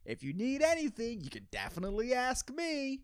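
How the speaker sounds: high, sweet eager salesman voice; a lightly mocking high salesman voice. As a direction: play the overly helpful car dealer. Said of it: "everyone's giving you that thumbs up".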